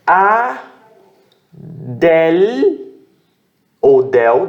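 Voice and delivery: a, del said as separate syllables; teacher splitting a-del into syllables. Shown as a demonstration. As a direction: break the word into syllables. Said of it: "dependendo de como você pronuncia".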